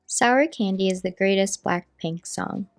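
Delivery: flat text-to-speech reader; robot voice. Acted. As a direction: read it like a computer voice. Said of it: "I'll have the robot voice read the post".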